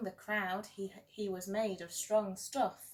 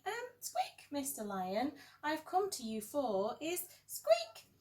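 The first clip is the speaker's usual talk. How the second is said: high, timid mouse voice with gulps; a tiny mouse trying to be polite to a giant lion; nervous little mouse; 5 semitones higher than usual. Acted. Mouse timidly wakes the lion, high and nervous.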